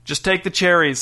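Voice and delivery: plain reading voice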